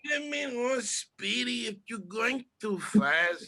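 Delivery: high, slow put-on cartoon voice; high cartoon impression; imitating cartoon voices. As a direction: ham up a cartoon character.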